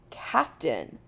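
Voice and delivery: low, firm insistence. A narrator's reading. McCoy insisting on rank to Jim, firmly. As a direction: hold your ground on the title. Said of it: "McCoy says firmly".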